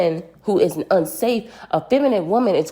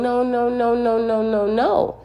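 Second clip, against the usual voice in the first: higher mock-sobbing no; lightly acted distress, pleading; a touch of a crying girl; imitated crying no; 6 semitones higher than usual. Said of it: "She's crying".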